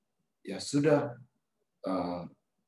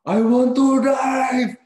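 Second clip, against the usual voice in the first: drawn-out shout, far higher and louder; imitated furious yell; 13 semitones higher, 10 dB louder, much slower than usual. Retelling Carl demanding the wheel, imitated yell. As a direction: scream each word slowly like a stubborn madman. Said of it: "si Carl berteriak".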